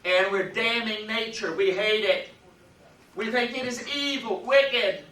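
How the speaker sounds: loud, high-pitched denunciation; mock condemnation. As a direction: denounce it loudly, voice pushed up high. Voicing moralists' condemnation of nature.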